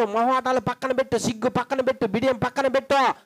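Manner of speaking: rising, insistent sermon commands, each line hammered; fiery preaching. Acted. Preacher urging the church to drop pride, fervent. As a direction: drive every put-it-aside home to the congregation.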